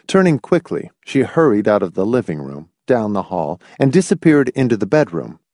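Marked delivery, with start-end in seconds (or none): none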